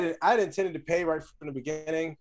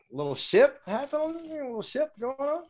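high teasing singsong; 11 semitones higher than usual. Real, not acted. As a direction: tease him about his love life. Teasing friend about relationship.

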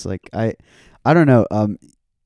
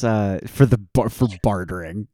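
ordinary talk, nothing marked

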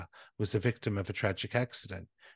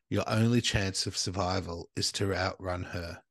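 normal talking voice

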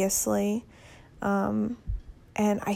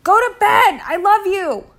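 calling out; 11 semitones higher, 12 dB louder than usual. Real, not acted. Calling to child through door.

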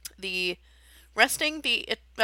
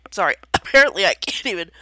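talking through a cough; choking between words; 9 dB louder, faster than usual. Real, not acted. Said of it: "I'm choking on the words".

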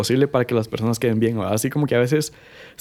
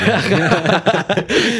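a bright, rising laugh that breaks out; burst of laughter; 7 semitones higher, 3 dB louder than usual. Real, not acted. Laughing with a friend about a silly ego contest, high laugh.

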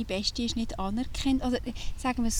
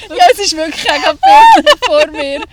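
much louder, higher, laughing; 7 semitones higher, 21 dB louder than usual. Real, not acted. Laughing as she tells her friend she really sees it. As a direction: say it while still cracking up.

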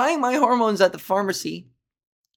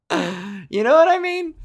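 1 laughing; 9 semitones higher, 4 dB louder, slower than usual. Real, not acted. Laughs at own rant.

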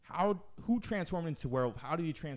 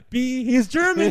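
slow, much higher and louder; mock outrage; 11 semitones higher, 14 dB louder, slower than usual. The fat kid's fear of the German guy, in slow mock alarm. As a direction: stretch the punchline out in mock alarm. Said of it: "he's scary about the German guy".

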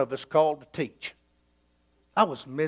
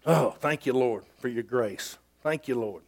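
1 quiet prayer; 8 semitones lower, 7 dB quieter than usual. Real, not acted.

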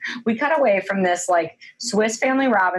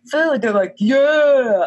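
mock cheer; 6 semitones higher, 4 dB louder, slower than usual. Dwarfs cheering for food.

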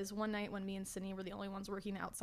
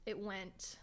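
ordinary talk

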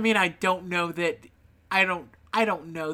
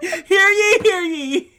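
booming high proclamation; town crier; 12 semitones higher, 11 dB louder, slower than usual. Pretend. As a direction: call it out like a herald. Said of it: "you'd read it like a decree".

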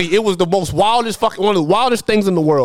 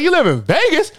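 surprised; 8 semitones higher than usual. Retold or imitated. Friend just said he moved.

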